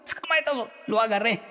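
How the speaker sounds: high, loud, gleeful call; mock teacher grabbing a student. Teacher catching a student in the hallway, high and gleeful. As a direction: pounce on the kid with a cheery cry. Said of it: "lo agarraba uno de".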